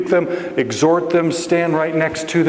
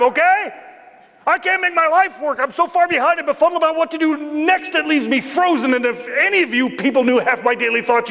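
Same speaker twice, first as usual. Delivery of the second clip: high, strained outburst to a whole room; fed-up outburst; 10 semitones higher than usual. Acted. Ripping off the mask before everybody, fed-up outburst. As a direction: finally let it all out, pitched way up.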